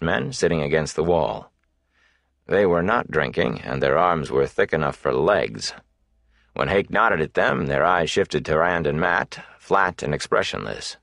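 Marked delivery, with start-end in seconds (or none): none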